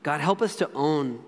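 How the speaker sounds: low, soft prayer with long pauses. Real, not acted. Preacher praying for hurting relationships, low and unhurried prayer. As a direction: pray quietly, haltingly, a phrase at a time.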